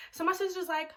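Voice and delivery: ordinary talk, nothing marked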